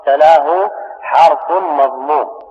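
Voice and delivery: ordinary talk, nothing marked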